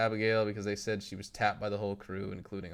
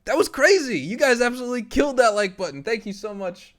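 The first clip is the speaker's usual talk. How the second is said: high, loud thrilled praise; excited; 11 semitones higher, 9 dB louder than usual. Real, not acted. Streamer praising viewers for the likes, high excited voice. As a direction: cheer the viewers on loudly.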